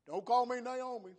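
preacher's bitter, mad Naomi, starting very loud; bitter outburst. Acted. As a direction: play Naomi lashing out, then easing down. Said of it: "I'm bitter, I'm mad".

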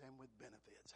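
preacher whispers to his congregation about God's blessings; whispered. Real, not acted. Hushed whisper. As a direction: drop to a breathy whisper.

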